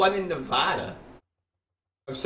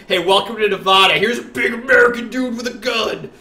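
hammed-up welcome; 7 semitones higher, 6 dB louder than usual. Mock greeting from game Nevada.

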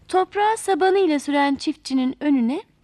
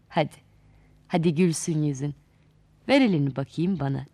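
put-on lower, patient old man correcting a spoiled girl; wise old grandfather; 6 semitones lower than usual. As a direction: speak like a kindly wise elder. Akıl gently teaches Talih.

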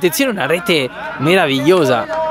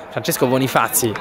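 ordinary talk

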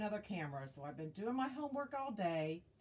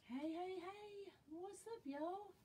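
singsong greeting; 11 semitones higher, 8 dB quieter than usual. Real, not acted. Welcoming new viewers to the stream, a high singsong hey. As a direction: sing it up high to welcome them.

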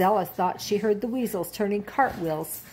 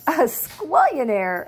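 put-on slow, higher playful child; little girl voice; 5 semitones higher, 4 dB louder, slower than usual. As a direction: savour the silly made-up word. Stella tops the bunnies.

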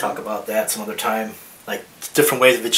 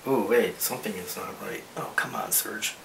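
muttering to himself; 2 semitones lower, 10 dB quieter than usual. Real, not acted. Artist chiding himself over a drawing mistake, muttered. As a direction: grumble under your breath at your own slip.

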